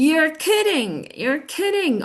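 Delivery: excited. Shown as a demonstration. Teacher shows a happy reaction, excited. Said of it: "I'm excited".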